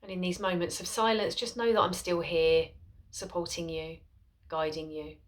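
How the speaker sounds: soft, unhurried voice with long pauses; guided meditation. Acted. Mindfulness teacher guiding followers into a seated body scan, soft guided meditation. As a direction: settle listeners gently into stillness, softly and spaciously.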